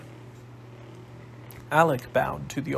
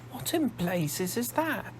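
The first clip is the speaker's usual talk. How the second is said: astonished; 8 semitones higher, 5 dB quieter, slower than usual. Acted. Shocked at odd contraption.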